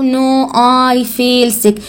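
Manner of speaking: No, I feel sick said very slowly; slow model answer. Shown as a demonstration. Teacher slowly models the sick answer for her pupil. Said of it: "أو".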